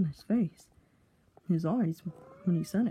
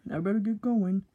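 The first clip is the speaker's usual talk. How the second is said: plain reading voice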